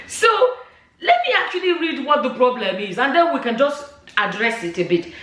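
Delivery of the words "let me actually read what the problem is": ordinary talk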